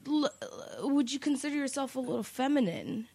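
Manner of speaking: halting, stuttering mock-timid question; hesitant stammer. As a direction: imitate a questioner awkwardly feeling his way. Awkward questioner stammering, retold.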